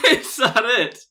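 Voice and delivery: high, loud laugh-through question; real laughter at the punchline; laughing disbelief. Real, not acted. Laughing disbelief at short article.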